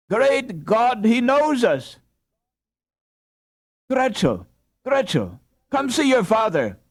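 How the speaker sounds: high, loud, overjoyed calling; ecstatic joy. A narrator's reading. Mother calling her daughter to see her father. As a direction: cry out in wild joy. Wife ecstatic at husband waking.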